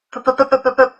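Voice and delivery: stuck on the p of please, repeating it; p-p-p-p-p-p- stammered, never reaching please; stuttering plea. A narrator's reading. Alison pleading with the bullies, stuck stammering on p. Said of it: "trying to plead with them to stop".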